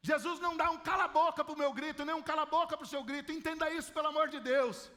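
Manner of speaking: ordinary talk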